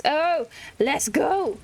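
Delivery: usual speaking voice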